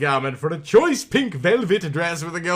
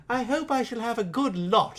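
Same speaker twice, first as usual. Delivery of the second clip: thinking to herself; 6 semitones higher, 5 dB quieter than usual. A narrator's reading. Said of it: "she thought to herself".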